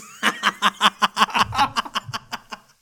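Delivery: big high burst of rapid laughs; loud real laughter. Real, not acted. Storyteller cracking up at her Christmas line, loud laughter. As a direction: crack up loudly and keep it going.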